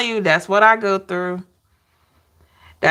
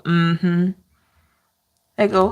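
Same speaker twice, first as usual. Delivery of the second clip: ordinary talk, nothing marked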